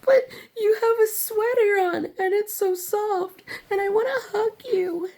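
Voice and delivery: put-on very high, whiny toddler begging; pleading child voice. Begging annoyed kids for a hug, high whiny plea. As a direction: plead like a little kid near tears. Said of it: "'Cause I'm like".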